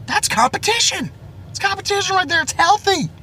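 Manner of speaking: very high, soft sneering falsetto; mocking falsetto. Mocking those who call it competition, in a high squeaky falsetto. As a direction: parody them in a squeaky high voice.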